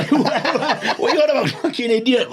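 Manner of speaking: laughing. Real, not acted. Laughing mockery of caller.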